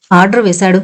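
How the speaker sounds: usual speaking voice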